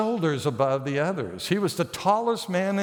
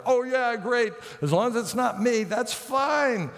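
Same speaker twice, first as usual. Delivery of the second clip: sarcastic Saul; 6 semitones higher than usual. Retold or imitated. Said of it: "Saul's like".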